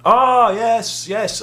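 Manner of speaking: high, loud bright "ah yes"; sudden recognition, loud and high; the boss suddenly recognising him, loud and high; delighted boss. Retold or imitated. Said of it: "And she went".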